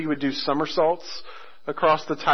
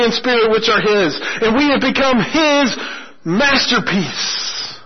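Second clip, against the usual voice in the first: preaching at full force; 7 semitones higher, 3 dB louder than usual. Acted. Preacher proclaims grace to the congregation, fervent sermon climax. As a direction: preach it out, building to the peak.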